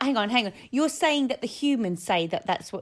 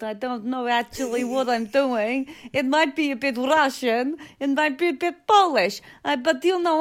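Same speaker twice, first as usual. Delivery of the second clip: rough Eastern European accent; Polish accent. Shown as a demonstration. Co-host trying out a Polish accent for approval, Eastern European accent. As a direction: put on a Polish accent drifting into Russian. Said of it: "I think I can do a Polish accent".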